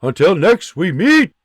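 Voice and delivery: parting shout from afar; shouted farewell. Acted. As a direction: call back as he vanishes.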